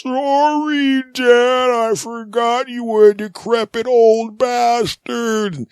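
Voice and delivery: very high, slow sheepish voice; apologetic son. Acted. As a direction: drag out the sorry like a guilty kid. Son apologizing after knockdown.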